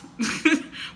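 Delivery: short high breathy laugh; quick self-amused laugh; amused chuckle. Real, not acted. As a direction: chuckle at your own phrase. Amused by her own wording.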